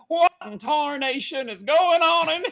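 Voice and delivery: put-on hugely amped-up Southern accent, high and loud; over-the-top Southern outburst; [name]. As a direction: holler slowly like a cartoon cowboy. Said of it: "I wanted it to just amp it up".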